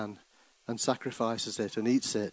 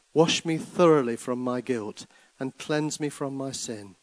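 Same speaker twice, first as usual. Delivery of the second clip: psalm reading; 3 semitones higher, 5 dB louder than usual. Acted. Reads Psalm 51 as prayer.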